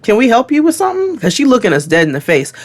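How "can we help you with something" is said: high, confrontational group challenge; pointed, pitched-up retold question; a little loud. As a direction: re-enact calling out a nosy stranger. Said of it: "it was a little loud".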